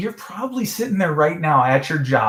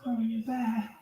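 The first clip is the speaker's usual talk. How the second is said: soft, high mock plea; pleading girlfriend; 8 semitones higher, 8 dB quieter, much slower than usual. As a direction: beg him softly to return. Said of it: "she's like".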